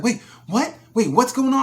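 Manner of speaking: high, loud alarmed cry of disbelief; the agent suddenly panics; startled agent. Retold or imitated. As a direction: customer service agent jolts into loud alarm. Agent hears bus on fire.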